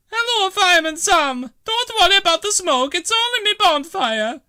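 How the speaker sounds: a bustling Italian landlady; Bella's voice. Acted. Bella clearing junk for her new bathroom, brisk.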